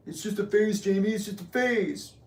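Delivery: exaggerated high patronizing tone; mocking dismissive voice. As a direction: mimic a patronizing relative soothing you. Someone brushing him off.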